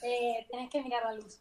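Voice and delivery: Argentine Maradona voice; Argentine accent. Retold or imitated. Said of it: "Como Maradona".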